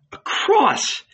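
high, startled cry; shocked exclamation. A narrator's reading. John startled by Jesus's words, crying out high. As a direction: gasp the word out in shock. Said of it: "John exclaimed".

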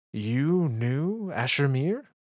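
slow, rising amazement; amazed question. Acted. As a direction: ask it in wonder. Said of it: "leaned forward in amazement".

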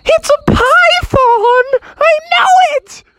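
very high, loud, drawn-out frog wail; panicked shriek. Acted. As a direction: shriek it slowly in mock terror. Frog panics about the python.

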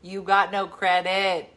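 You got no credit, drawn out slowly word by word; slowed-down lesson voice; slow emphatic repeat. Shown as a demonstration. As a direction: spell it out slowly so a kid gets it. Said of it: "Let them know words like".

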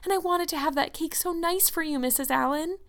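high, plaintive forlorn voice. A narrator's reading. Anne wanted the cake nice for Missus Allen, forlornly. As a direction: confess the disappointment plaintively. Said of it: "said Anne forlornly".

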